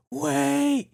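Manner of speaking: a sudden high yelp; panicked yell. Retold or imitated. Guard yells at speaker grabbing his iPad, high panicked yelp. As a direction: cry out high as he grabs for it. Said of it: "And he goes".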